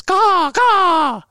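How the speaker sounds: high loud crow caw; shrill screeching bird woman. Shown as a demonstration. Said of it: "It'll sound something like this".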